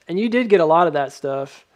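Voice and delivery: ordinary delivery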